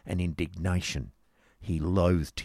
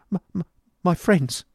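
put-on high, halting, wretched boy; faltering and high-pitched; distressed stammer; 11 semitones higher, 6 dB louder than usual. A narrator's reading. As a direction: stutter it out in a high, upset voice. Distressed boy stammers his answer.

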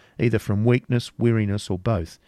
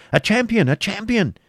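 much higher, loud crowd cry; exclaimed; 12 semitones higher, 4 dB louder than usual. A narrator's reading. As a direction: shout it out as the whole crowd. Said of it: "A hundred voices exclaimed".

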